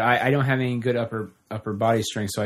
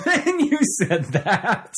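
laughing through the words; 8 semitones higher, much slower than usual. Real, not acted. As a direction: laugh while saying it. Laughing reply.

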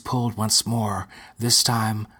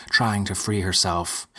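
plain reading voice

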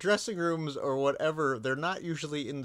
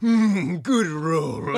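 loud, higher-pitched hammed-up horror-star impression; Vincent Price; 4 semitones higher, 8 dB louder, much slower than usual. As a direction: savor it like a theatrical movie villain. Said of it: "he just did the impression of him".

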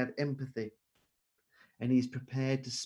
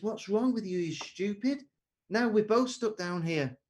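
higher, storyteller's mock scolding; trapped man scolds his friend, higher-pitched mock exasperation; exasperated outburst; 7 semitones higher than usual. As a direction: berate the friend who jumped in, half-playful.

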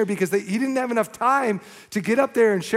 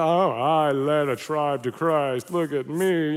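slow, lower, self-important put-on voice; mock boasting; 4 semitones lower, slower than usual. Preacher mocking believers who claim the glory, slow mock boasting. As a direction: draw it out like a pompous show-off. Mocks someone taking the glory.

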